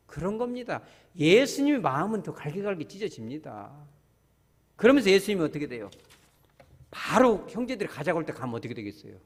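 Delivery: normal talking voice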